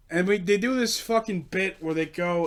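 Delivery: usual speaking voice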